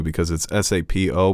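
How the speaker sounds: ordinary delivery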